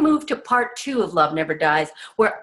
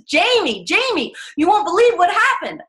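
much higher and louder, bursting with news; excited rush; 10 semitones higher, 4 dB louder than usual. Retold or imitated. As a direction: blurt it out breathless with excitement. Gabe stampedes her at restaurant.

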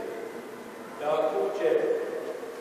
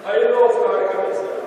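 auctioneer; 5 semitones higher, 5 dB louder than usual. Real, not acted. Auctioneer calling bids.

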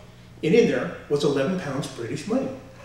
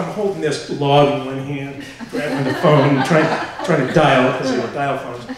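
normal talking voice

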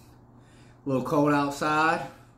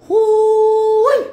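loud whoop; 14 dB louder than usual. Real, not acted. Out of the cold plunge.

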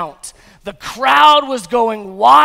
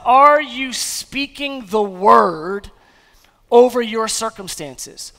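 slow, building, louder with every question; full-force preaching; 7 dB louder than usual. Acted. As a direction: drive each question harder toward the shout. Preaching climax.

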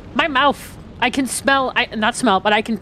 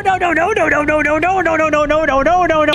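panicked no's; 3 semitones higher, faster than usual. Real, not acted. Enemy grabbing him in game.

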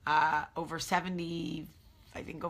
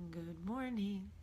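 drawn-out, higher sing-song good morning; mock sing-song greeting; 4 semitones higher, 6 dB quieter, slower than usual. Shown as a demonstration. Greeting her viewers in a drawn-out sing-song voice. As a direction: stretch good morning playfully like a cartoon greeting.

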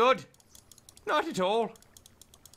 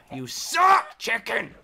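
loud insult; 5 dB louder, slower than usual. Real, not acted. Player telling off a chicken, loud outburst. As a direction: burst out louder on the insult.

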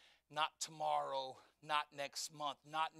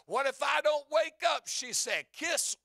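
loud, high, excited revival storytelling; full-force preaching; 6 semitones higher, 8 dB louder than usual. Acted. Preacher retelling the wife's testimony to the church, fervent. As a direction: drive the testimony home at a preacher's pitch.

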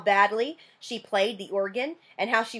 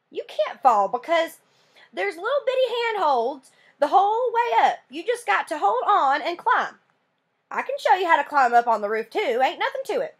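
put-on a higher, eager country boy explaining the climb; a lightly voiced boy, boastful and breezy; Burke, higher boy's voice; 8 semitones higher than usual. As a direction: pitch it up as a cocky boy who fears nothing.

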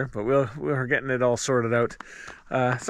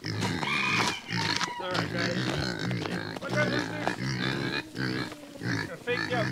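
low, rumbling playful voice; deep voice for the chickens; 4 dB quieter, much slower than usual. Pretend. Farmer teasing his chickens in a very low, playful voice. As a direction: drop way down and tease the hens.

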